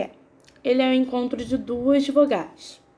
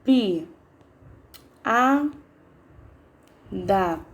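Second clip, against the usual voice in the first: "pi, a, da" with a pause after each syllable; much slower than usual. Shown as a demonstration. Teacher separating piada for the class, syllable by syllable. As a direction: count out piada one syllable at a time. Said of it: "Por último".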